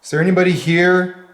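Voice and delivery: loud, projected questions to the empty house; calling out loud. Real, not acted. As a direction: call out to the spirits. Ghost hunter calling out to spirits.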